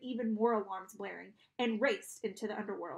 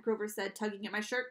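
ordinary delivery

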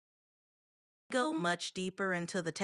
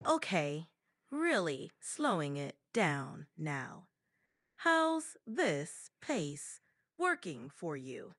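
extremely slow, gaps between words; maximally slow; 4 semitones lower, slower than usual. Shown as a demonstration. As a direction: drag it out word by word. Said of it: "Maximally slow".

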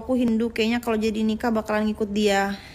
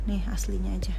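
whisper; 3 semitones lower than usual. Real, not acted. Shows her real face without filter.

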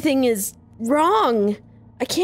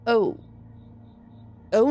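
ordinary delivery

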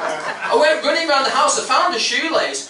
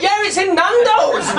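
gleeful blurt; 9 semitones higher, 4 dB louder, slower than usual. Retold or imitated. Proud answer in class.

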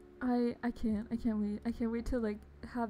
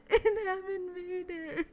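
laughing; 4 semitones higher, 4 dB louder, slower than usual. Real, not acted. Laughing while thanking gifter.

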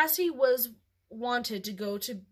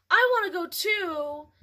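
put-on begging little girl; 7 semitones higher, 5 dB louder than usual. A narrator's reading. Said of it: "she begged".

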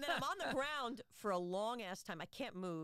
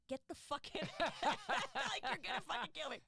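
laughing, a little louder mock yell; mock scream; 5 semitones higher, 4 dB louder than usual. Friend whose hand is crushed, in a laughing mock yell. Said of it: "she's screaming, and she goes".

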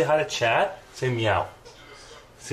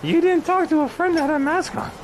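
high-pitched exclamation to the dog; much higher, playful outburst; mock astonishment; 14 semitones higher than usual. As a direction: squeal it at the dog in pretend shock.

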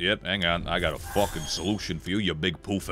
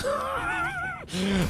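falsetto giggle; high shrieking laugh; squeaky high laugh; 3 dB quieter than usual. Real, not acted.

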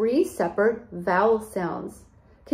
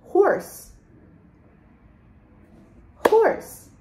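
Horse as one short, higher-pitched beat; single beat; 9 semitones higher, 3 dB louder than usual. Shown as a demonstration. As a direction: say Horse in one high, falling burst. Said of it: "let's clap out how many syllables".

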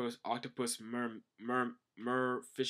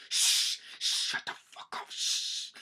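forceful whispered shushing; urgent hushing whisper; slower than usual. Acted. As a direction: whisper hard to silence someone. Character hushes Brook urgently.

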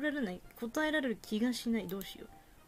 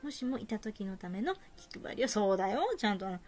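ordinary delivery

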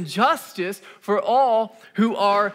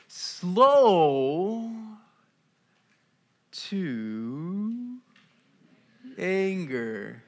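drawn out; much slower than usual. Shown as a demonstration. Slowly drawing out scripture.

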